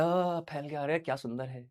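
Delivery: hushed, soft gasp of wonder; softly marvelling at a beautiful sight; awed admiration. Acted. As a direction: play mock wonder, low and soft. Pupil voiced admiring something beautiful.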